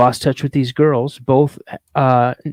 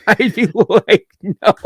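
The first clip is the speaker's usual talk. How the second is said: higher, slow words through real laughter; laughing; 6 semitones higher, 3 dB louder, slower than usual. Real, not acted. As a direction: crack up while telling it. Cracks up finishing his own story.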